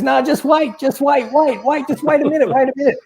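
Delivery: high, repeated anxious plea; urgent pleading. Retold or imitated. Bus driver to passenger about to be sick, urgent. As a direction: act out a flustered driver stalling.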